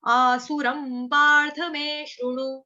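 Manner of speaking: "आसुरम पार्थ मे शृणु" slowly, stressed on Partha; slow recitation. Shown as a demonstration. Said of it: "आसुरम भी बहुत बार हो गया". (धीमा श्लोक पाठ)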